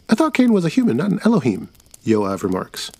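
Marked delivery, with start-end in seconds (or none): 0.1-1.7 put-on much higher boy's voice, doubting; a kid poking holes in the story. Kid Yoav objects.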